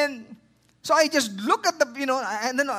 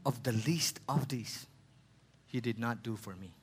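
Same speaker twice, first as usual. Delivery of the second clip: solemn scripture reading; 9 semitones lower, 6 dB quieter than usual. Acted. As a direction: read the king's words gently, like holy text. Reads the king's reply again.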